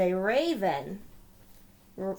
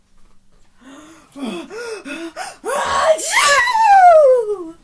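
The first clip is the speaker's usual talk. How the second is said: a big, loud, high sneeze that bursts out; huge bear sneeze; 19 dB louder than usual. Acted. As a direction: let the sneeze explode. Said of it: "He blows and he sneezes".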